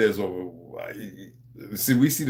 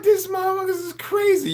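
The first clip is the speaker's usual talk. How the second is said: mock shout. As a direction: shout it like you can't believe them. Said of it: "I'm like".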